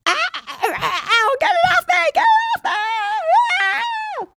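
high screaming panic, get it off; mauled by a tiger. Acted. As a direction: shriek as a tiger grabs you. Mock tiger attack after greeting.

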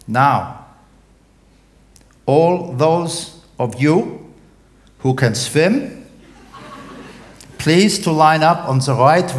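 captain instructing passengers to line up; captain's announcement. Acted. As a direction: give calm, careful orders to the passengers. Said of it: "the captain's voice comes over the speaker again".

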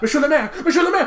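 high-pitched frantic calling out; mock screaming. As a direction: imitate a desperate scream for the mayor. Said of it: "She is screaming for".

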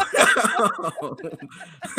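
long rising laugh. Real, not acted. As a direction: burst out laughing. Laughs at quarterback overthrowing receivers.

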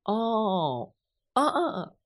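high, drawn-out aha of realization; little students suddenly getting it; students' dawning aha. Acted.

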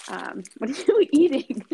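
amused, laughing question. Real, not acted. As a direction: laugh while asking it. She laughs at him eating.

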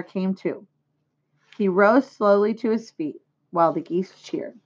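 plain reading voice

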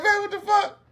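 very high mock-baffled outburst; retold disbelief. Man handed the check unexpectedly, high mock disbelief. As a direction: pitch it way up, comically appalled.

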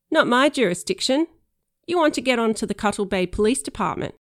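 loud, offhand cop brushing him off; bored cop. Pretend. Girl plays bored cop.

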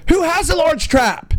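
disbelieving, pitch leaping way up; who on earth owns one?; a squeaky disbelieving question; incredulous. Real, not acted. Incredulous at the trap request.

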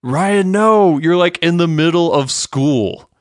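high, loud retold protest, easing off; alarmed pleading. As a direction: call out to stop a friend's blunder. Voices alarmed reaction to the fight.

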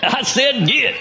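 high-pitched, hollered Texan command; Texan exorcist cowboy. Acted. Punchline of the Texorcist cartoon, hammed-up Texan holler. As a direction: order the demon out, cowboy-style. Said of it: "That's how they do it in Texas".

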